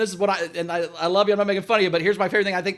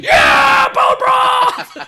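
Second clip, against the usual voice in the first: high, loud whooping shout; excited cheer; 7 dB louder, much slower than usual. Retold or imitated. Hosts cheering the secret word bone broth, loud whoop. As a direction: yell it like the crowd going bananas.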